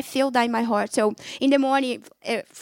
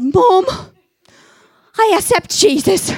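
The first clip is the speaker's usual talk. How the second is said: raised, louder declaration to mom; excited announcement; 7 semitones higher, 7 dB louder than usual. Retold or imitated. Daughter telling her mom she accepts Jesus, excited. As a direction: blurt it out to mom, thrilled.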